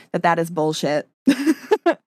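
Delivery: real laugh breaking into the words; calling it out through a giggle. Real, not acted. Telling listeners the message is bullshit, laughing.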